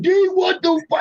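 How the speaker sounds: high, shouted what the fuck; shocked shout. Retold or imitated. Big Baby spotting his old friend in the smoke shop, shouted high in shock. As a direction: yell it, floored to see an old friend. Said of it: "he was like".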